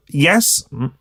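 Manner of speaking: ordinary delivery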